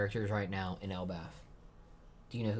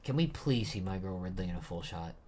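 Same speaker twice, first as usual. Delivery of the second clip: usual speaking voice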